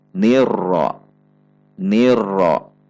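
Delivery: "Nirro" with light ni, then heavy ro; thin then thick. Shown as a demonstration. Teacher repeats correct model, thin ni thick ro. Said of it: "Ni-nya masih tipis".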